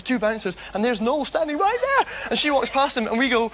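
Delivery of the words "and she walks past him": ordinary talk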